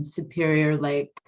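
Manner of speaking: normal talking voice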